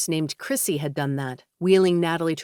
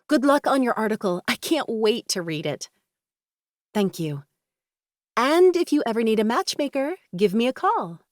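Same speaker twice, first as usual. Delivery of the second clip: higher, bubbly friend; cheerful friend voice; 6 semitones higher than usual. A narrator's reading. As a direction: tease her lightly, bright and lifted. Kendra teasing farewell.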